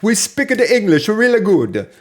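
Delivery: high, loud hammed-up foreign accent; goofy broken-English accent; mock accent. Repeating the joke line for Matthew in a mock accent. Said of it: "That's, that's what that was".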